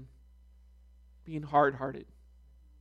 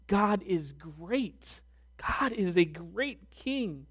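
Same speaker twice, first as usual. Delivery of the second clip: slow, softer, weighty; solemn emphasis; 5 dB quieter, slower than usual. Shown as a demonstration. Preacher stressing that God is a great king. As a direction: let great sink in slowly. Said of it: "that word great".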